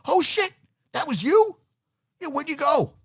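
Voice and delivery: very high, acted astonished fan; mock amazement. Tailgater recognizes former quarterback, amazed. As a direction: squeal in put-on recognition.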